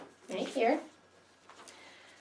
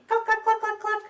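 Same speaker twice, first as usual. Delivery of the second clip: high mock clucking like hens; clucking chickens; 14 semitones higher, 3 dB louder than usual. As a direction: cluck like a noisy hen.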